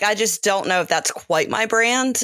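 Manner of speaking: ordinary talk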